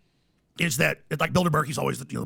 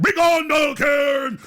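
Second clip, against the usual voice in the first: drawn-out high theatrical shout; screaming incantation; 9 semitones higher, 6 dB louder, much slower than usual. Acted. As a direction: bellow it like a ritual banishment.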